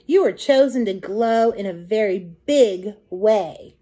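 plain reading voice

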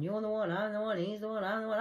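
normal talking voice

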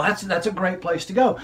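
normal talking voice

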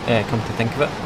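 ordinary talk

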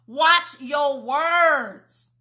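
each word slow and heavy; slow, deliberate warning; slow emphatic. Real, not acted. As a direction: drive it home, word by word. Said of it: "preacher drives the point home".

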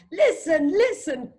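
high, urgent storybook cry. A narrator's reading. Teacher reading the frightened goat, crying out high. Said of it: "cried goat again".

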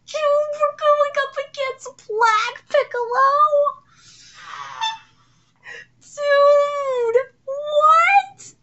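very high, loud drawn-out squeal; stunned disbelief. Real, not acted. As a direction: squeal in shocked excitement.

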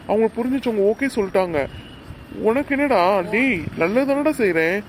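high, insistent self-defence; exasperated pleading. Acted. Aunt begging her nephew to understand her good intentions, exasperated pleading. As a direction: plead with rising exasperation, pitch climbing well up.

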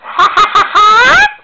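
giggling shriek; high shrill laugh. Pretend. Vlogger cracking up at himself, high laugh. After silly bit.

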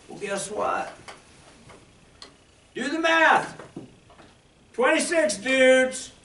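high, loud preacher energy; rally the congregation loudly; fired-up preaching. Acted. Preaching at full force.

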